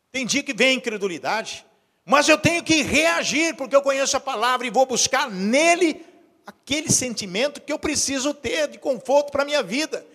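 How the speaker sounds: high, forceful pulpit exhortation; impassioned preaching. Real, not acted. Pastor challenging the congregation to change, impassioned preaching.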